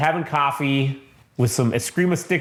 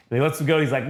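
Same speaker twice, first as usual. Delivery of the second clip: ordinary talk, nothing marked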